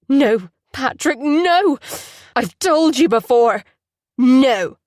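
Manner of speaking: loud, slow furious refusal; furious shouting. Acted. Patrick refusing his brother, furious shouting. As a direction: shout him down in fury. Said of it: "a wave of fury".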